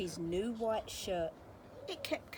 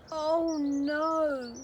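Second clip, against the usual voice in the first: a higher, louder, shocked oh no; dismayed cry; 8 semitones higher, 7 dB louder than usual. A narrator's reading. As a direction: cry out in dismay at the closed shop. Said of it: "cried George".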